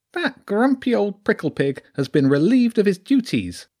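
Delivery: a sly lounging weasel with a rural Sussex lilt; high-pitched sneaky creature with a country twang. Acted. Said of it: "Its voice was high".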